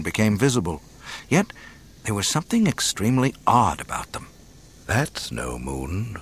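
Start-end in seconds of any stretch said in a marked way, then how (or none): 4.9-6.2 low, slow and hushed; breathed softly. A narrator's reading. Kenobi on seeing the false moon, breathed softly. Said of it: "Kenobi breathed softly".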